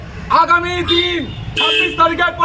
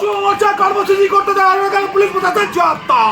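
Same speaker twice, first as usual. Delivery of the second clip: fast, shouted protest slogan; rally the crowd with a shouted chant; faster than usual. Real, not acted. Protest leader leading slogans.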